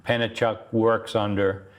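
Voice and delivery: ordinary delivery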